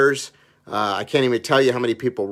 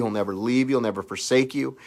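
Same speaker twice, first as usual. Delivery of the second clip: normal talking voice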